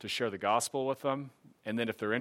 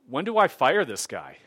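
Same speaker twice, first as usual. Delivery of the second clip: louder, mock-impatient question; comic exasperated demand; retold fed-up complaint; 3 semitones higher, 8 dB louder than usual. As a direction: act out being fed up, for a laugh. Retold complaint about flaky disciple.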